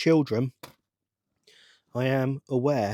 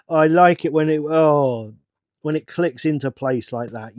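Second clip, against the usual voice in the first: excited; 3 semitones higher, 10 dB louder than usual. Real, not acted. Builder enjoying a piece clicking into place, excited. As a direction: savour the click out loud. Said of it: "I am much more excited about this".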